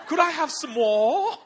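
loud, high, slow British orphan plea; mock-British pleading; Oliver Twist. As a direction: beg in a hammed-up British accent. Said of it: "the spirit of Oliver Twist came on him".

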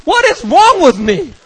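high, drawn-out outburst; acted incredulous wail; exasperated. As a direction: berate yourself in disbelief. Exasperated self-questioning at home.

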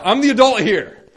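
re-enacted outburst, high and very loud; infuriated yell. Caretaker setting a smirking boy straight, infuriated yell. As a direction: assert authority at full volume. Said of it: "I'm infuriated".

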